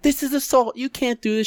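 high, squealing mock victim; high mock-wounded whine; whiny shoplifter. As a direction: squeal it like a caught thief playing hurt. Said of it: "the woman's like".

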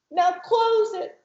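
put-on high and slow, a small child's instruction; little girl voice. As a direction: draw it out gently like a little girl. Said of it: "she's talking like this".

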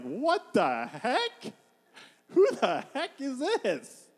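very slow, high mock disbelief; incredulous contempt. Goliath seeing his puny challenger, incredulous contempt. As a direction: drag out each word in hammed-up bafflement. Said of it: "He's like".

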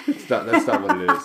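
high-pitched, laughing fast; cracking up while protesting; laughing hard. Real, not acted. Laughing at his own mistake.

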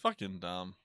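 normal talking voice

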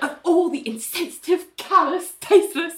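high-pitched mock outrage; indignant. Ali having a go at Ling, indignant.

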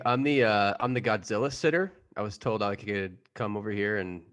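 normal talking voice

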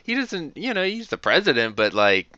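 high, loud ranting; heated rising frustration; worked up. Real, not acted. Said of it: "Getting all wound up".

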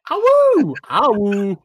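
high yipping wolf howl; howling like a wolf pup. Acted. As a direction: throw back your head and howl. Howls after song joke.

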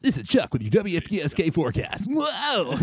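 high hammy radio DJ voice; radio character voice. Acted. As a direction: play a goofy late-night weatherman. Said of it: "I'd create all these characters".

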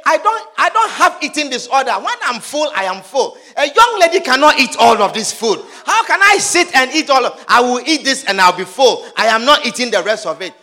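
loud, emphatic self-resolve; determined refusal. Acted. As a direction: firmly refuse at full voice. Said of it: "You determine and say".

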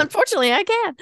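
rueful high repeat. Real, not acted. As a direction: toss it off up high with a rueful laugh.

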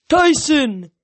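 loud, high booming call; big fatherly shout; roar. Acted. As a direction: roar the name out proudly. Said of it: "he roared".

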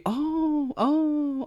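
high, soft cooing oh; drawn-out little oohs of noticing; mock impressed. Mimics himself noticing film technique.

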